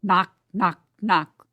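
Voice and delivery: calling out knock knock, pounding each word; booming porter's knock; loud knocking call. Acted. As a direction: bang out each knock like fists on the gate. Porter mimics knocking at the gate.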